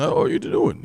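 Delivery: high, breathy mock-friendly greeting; fake-nice greeting. Mocking polite store clerks, high and airy. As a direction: squeak it out all sweetness. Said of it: "they talk like that".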